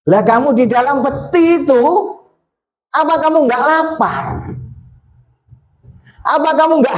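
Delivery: high-pitched, incredulous string of questions; amazed questioning. Retold or imitated. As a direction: press the child with high, wondering questions. Retells questioning of the boy.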